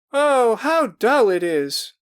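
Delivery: high, loud, slow whining; bored girls crying. A narrator's reading. Girls complaining the game is dull, high slow whine. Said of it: "cried voices".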